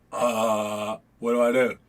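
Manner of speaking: low, hushed moment of being at a loss; mock dismay. Movie hero finds no bullets in his gun, mock dismay. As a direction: imitate a stumped hero thinking aloud.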